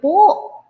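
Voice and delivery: po sung out high and loud; stress on po. Shown as a demonstration. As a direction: lift po up high and loud, proudly. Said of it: "I love to use the word".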